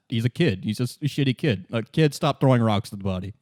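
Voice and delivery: usual speaking voice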